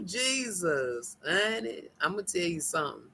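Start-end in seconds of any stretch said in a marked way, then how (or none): none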